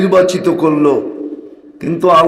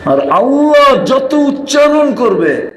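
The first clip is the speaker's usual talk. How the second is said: fervent preaching; 5 semitones higher than usual. Acted.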